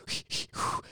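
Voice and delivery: Lamaze-style puffed breaths; quick puffs, like breathing through labor; mock calming breaths; panic breathing. Demonstrates birth breathing against panic.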